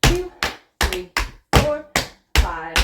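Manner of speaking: counting the beat. Shown as a demonstration. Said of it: "So it's".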